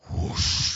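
loud rushing whoosh noise; imitated whoosh. Fire comes down on the altar, explosive whoosh. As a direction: mimic a blast of falling fire.

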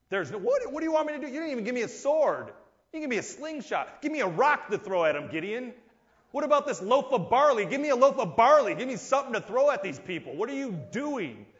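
a soldier protesting his useless gear; exasperated soldier. Acted. Soldier complaining to Gideon.

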